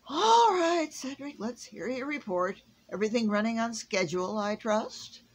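high, loud, fast questioning; a jumpy Santa voice; Santa, suddenly brisk. A narrator's reading. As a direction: hurry the elf along. Santa asks for report.

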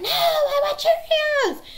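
put-on loud, high, drawn-out child shriek; piercing mock tantrum yell; imitated screaming back. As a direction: mimic a five-year-old screaming back. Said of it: "my five-year-old screaming back".

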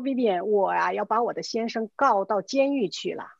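ordinary talk, nothing marked

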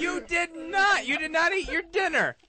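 put-on stern parent scolding a child. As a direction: scold him like a naughty kid.

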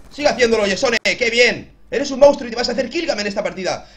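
loud, excited hype; excited cheering. Real, not acted. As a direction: cheer yourself on. Excited self-cheering mid-game.

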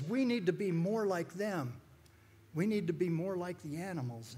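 high, emphatic mock pleading; mock tearful protest. Tearful protester urges exterminator to admire animals, mock tearful. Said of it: "just crying tears and just going off".

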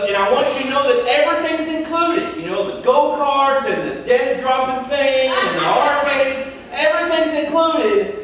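upbeat overeager employee voice; chipper teen clerk. Retold or imitated. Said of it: "chipper sixteen-year-old guy says".

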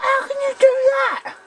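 very high, squeaky indignant cry; outraged disbelief. Real, not acted. Squealing at his teammate who hit him, outraged. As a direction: shriek it in disbelief.

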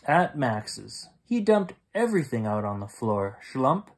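ordinary delivery, nothing marked

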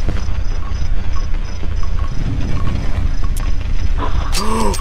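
sudden frightened gasp; sharp gasp. Real, not acted. Jump scare in horror game.

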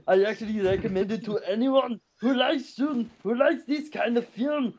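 put-on Austrian action hero accent; Arnold voice. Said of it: "just doing an Arnold voice".

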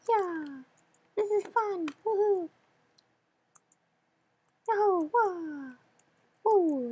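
high, bright-eyed cheering whoops; high inner child voice. Acted. Player whooping through the level as his inner child, in a high voice. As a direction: whoop like an overexcited little kid. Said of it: "back to my regular voice".

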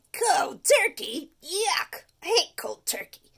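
loud, drawn-out yuck; disgusted tooth. Acted. The tooth disgusted by cold turkey. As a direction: recoil at the idea.

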